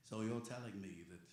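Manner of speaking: a rough go at Obi-Wan; bad Obi-Wan. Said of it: "this is my bad, uh, Obi-Wan".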